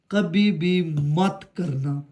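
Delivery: very slow, word by word; slow, measured warning with weight on every word; emphatic warning. Real, not acted. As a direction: drag out each word as a firm caution. Warns beginners against a mistake.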